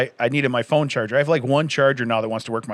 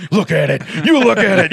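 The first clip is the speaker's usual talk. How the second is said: laughing mock wife; 5 semitones higher, 6 dB louder than usual.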